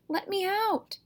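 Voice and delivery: high, strained cry from the sack; a trapped thing screaming to get free; muffled voice shrieking from inside a sack; harsh cry. Acted. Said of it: "a harsh voice cried".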